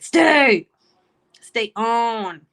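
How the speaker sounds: loud call to stay. Real, not acted. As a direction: call them back, pleading. Begging viewer to stay on.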